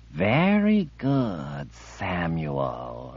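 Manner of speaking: slow, soft, fawning coo. Acted. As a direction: purr it like praising a pet. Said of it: "she cooed".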